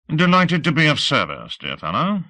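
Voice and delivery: put-on courtly colonel with a faint British accent. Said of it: "The accent was faintly British".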